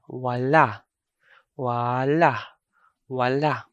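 "wala" with a long first A, normal second A; long first vowel. Shown as a demonstration. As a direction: say each "wala" stretching the first vowel, ending open. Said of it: "a long vowel".

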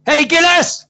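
high, very loud angry hail; aggressive shout. Retold or imitated. Coworker accosting the teller, aggressive shout. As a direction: holler it like an aggressive man. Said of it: "Very aggressive, isn't he?".

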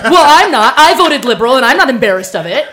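loud, defiant insistence; indignant retort. Retold or imitated. S0 retelling her reply in a heated discussion, loud and defiant. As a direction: fire it back, proud and loud. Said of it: "And I said".

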